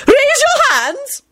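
extremely high, slow screech; piercing drawn-out demand; screeched command. A narrator's reading. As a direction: shriek the order, stretching the words. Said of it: "Lady Fanshawe screeched".

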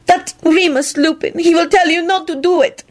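high-pitched, distressed wail; upset wail, pitched high. A narrator's reading. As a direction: lament it miserably. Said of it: "Cosmina wailed".

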